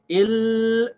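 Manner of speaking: IL with a long, high, held l; dragged lam. Shown as a demonstration. Teacher dragging the sound of the lam, drawn out. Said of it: "by dragging the sound of the lam".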